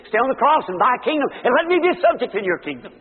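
loud, high, desperate plea from the cross; pleading thief. Acted. Preacher voicing the thief on the cross, loud pleading. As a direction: beg like a dying thief.

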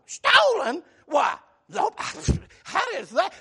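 high-pitched feigned astonishment; mock surprise. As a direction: act shocked, pitch jumping up, like a bad liar. Mimics suspect feigning surprise.

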